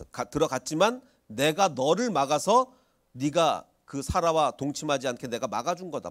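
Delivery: ordinary talk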